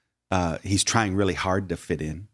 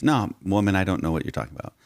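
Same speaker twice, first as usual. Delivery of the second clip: fast, brushing-off denial; hasty denial; faster than usual. Retold or imitated. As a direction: wave her off quickly. Peter denies to the girl.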